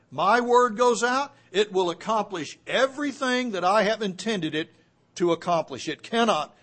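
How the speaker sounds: forceful preaching. Acted. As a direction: drive the Word of God home.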